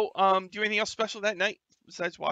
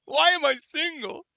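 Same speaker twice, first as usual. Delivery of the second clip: mock lament; 11 semitones higher, 8 dB louder than usual. Mock lament after people skills jab.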